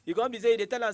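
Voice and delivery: Nigerian Pidgin accent, easy recap. Real, not acted. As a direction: Nigerian Pidgin, relaxed podcast delivery. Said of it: "speaking Nigerian Pidgin throughout".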